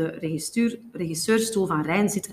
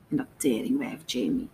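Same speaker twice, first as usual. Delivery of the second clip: low, soft grumble; dropped-pitch drunken mumble; muttering; 6 semitones lower, 6 dB quieter than usual. A narrator's reading. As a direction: mutter a curse under your breath. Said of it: "Gongrijp mompelt".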